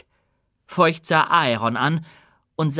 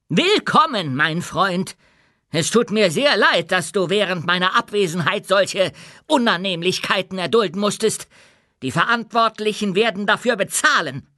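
high, raised voice for a public welcome; loud proclamation; 7 semitones higher, 4 dB louder than usual. A narrator's reading. King welcomes his friend before the crowd, loudly. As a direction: project it indignantly to a whole crowd. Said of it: "sagte er mit lauter Stimme".

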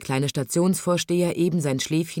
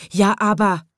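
protesting; 4 semitones higher, 6 dB louder than usual. A narrator's reading. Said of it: "sagten die Leute".

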